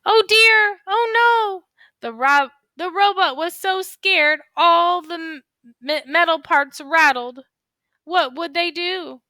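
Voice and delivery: high, loud, frightened robot voice; scared robot voice. Acted. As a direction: squeak it out in a panic. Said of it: "make sure your voice sounds exciting too".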